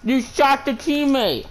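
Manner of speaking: high, slow, shocked outcry; shocked yell. Real, not acted. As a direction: cry out in disbelief. Yelling at teammate in game.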